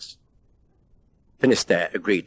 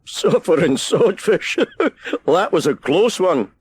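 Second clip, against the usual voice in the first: high, slow, giggly relief; a giggle in the voice after a false alarm; giggling; 9 semitones higher, 3 dB louder, slower than usual. A narrator's reading. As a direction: laugh off the near miss. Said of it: "he giggled".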